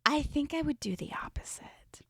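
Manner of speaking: unvoiced aside whisper. Real, not acted. Confiding her opinion to her co-host, whispered. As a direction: confide it under breath.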